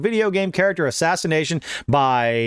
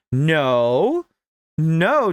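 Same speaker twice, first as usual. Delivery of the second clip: ordinary talk, nothing marked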